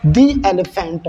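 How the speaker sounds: दी with a long ee before एलिफेंट, slow; THE as "dee" before vowel. Shown as a demonstration. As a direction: demonstrate दी एलिफेंट slowly, low in pitch. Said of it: "दी पढ़ेंगे".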